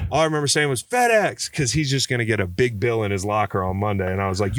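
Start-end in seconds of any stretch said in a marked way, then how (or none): 0.8-1.5 mock calling out. Repeats retold taunt to brother.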